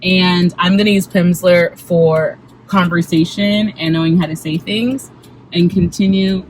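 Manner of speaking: normal talking voice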